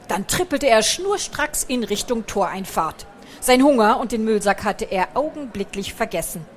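usual speaking voice

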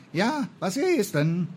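high, drawn-out singsong reply; carefree high-pitched response; cheerful answer. A narrator's reading. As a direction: call back cheerily, slow and high. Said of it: "kam es fröhlich zurück".

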